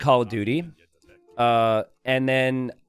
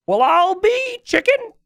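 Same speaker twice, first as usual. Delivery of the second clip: put-on old man voice; 14 semitones higher, 4 dB louder than usual. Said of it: "There's this old man on the other end".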